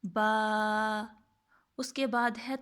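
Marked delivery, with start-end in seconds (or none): 0.0-1.0 با with both lips pressed, held two beats; demonstrated ba. Shown as a demonstration. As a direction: close the lips and stretch با. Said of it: "کیسے پڑھیں گے؟".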